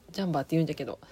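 ordinary talk, nothing marked

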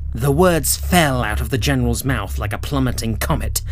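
ordinary delivery, nothing marked

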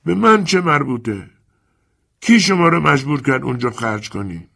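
high, offhand who-cares; indifferent shrug. Acted. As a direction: shrug it off coolly.

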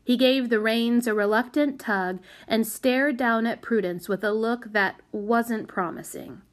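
usual speaking voice

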